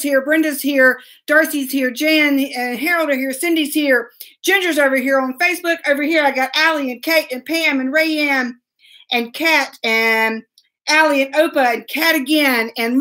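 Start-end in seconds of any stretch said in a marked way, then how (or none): none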